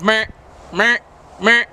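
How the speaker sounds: short repeated mer sounds; duck call syllable. Shown as a demonstration. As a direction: say mer like blowing a quack. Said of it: "the basic quack is just".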